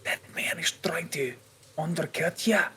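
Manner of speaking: very soft, voiceless whisper; whispered warning. Acted. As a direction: whisper a secret tip to a companion. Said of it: "I'm whispering to him".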